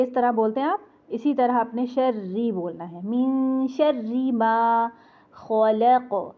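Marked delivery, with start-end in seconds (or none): 3.1-6.3 slow melodic tajweed chant of कुल अऊज़ु बिरब्बिल फलक; Quran recitation. Shown as a demonstration.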